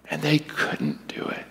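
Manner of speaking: breathy whisper, all voice gone; trailing whisper. Retold or imitated. A father confessing the disciples failed, in a whisper. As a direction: let the last words fall to a hushed whisper.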